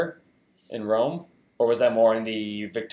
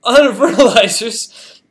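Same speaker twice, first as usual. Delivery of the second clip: higher, much louder burst of excitement; excited exclamation; 9 semitones higher, 12 dB louder than usual. Real, not acted. Player reacting to a game reward, excited. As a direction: cry it out, amazed at the haul.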